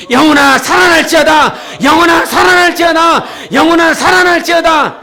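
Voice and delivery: high, full-force rallying shout. Shown as a demonstration. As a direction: shout it as a repeated declaration of faith. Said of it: "이렇게 외쳤어". (높고 힘차게 외치는 선포)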